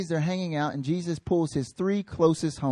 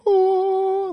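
amazed gasp. Retold or imitated. Reacting to shining face.

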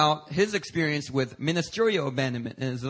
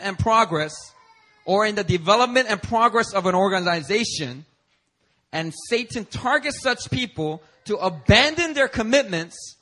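raised, forceful preaching voice; 4 semitones higher, 3 dB louder than usual. Acted. Pastor preaching hard to the congregation about abandonment. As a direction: drive the definition home from the pulpit.

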